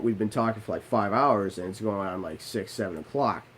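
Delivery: ordinary talk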